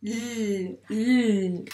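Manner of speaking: L as a held lll sound; letter sound demo. Shown as a demonstration. Teacher answering what the last letter does, held L sound. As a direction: hum the L, not the letter name. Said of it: "elle fait quoi".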